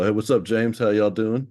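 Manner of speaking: ordinary delivery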